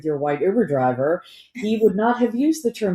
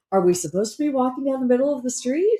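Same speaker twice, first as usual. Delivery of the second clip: high, quick, worried asking; worried question; 7 semitones higher, faster than usual. Retold or imitated. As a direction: ask it as a gently alarmed friend. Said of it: "and said".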